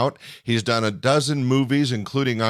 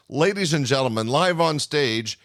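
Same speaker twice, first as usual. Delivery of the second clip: announcer intro; 3 semitones higher, slower than usual. Real, not acted. Club owner introduces act.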